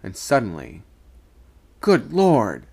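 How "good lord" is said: sudden loud cry of alarm; startled yell. A narrator's reading. Sailor losing his balance over the side, yelling in alarm. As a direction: blurt it out as you topple. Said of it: "how I yelled".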